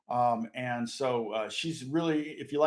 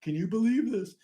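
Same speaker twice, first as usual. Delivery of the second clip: high, thrilled question; excited question to wife; excited disbelief; 7 semitones higher than usual. Retold or imitated. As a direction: share the good news with wife. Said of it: "My wife and I were like".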